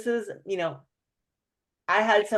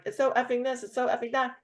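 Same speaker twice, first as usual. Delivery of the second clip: higher, exasperated venting; mock his fed-up swearing; lightly voiced frustrated man, cursing; frustrated cursing guy; 5 semitones higher than usual. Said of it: "using the F word left and right".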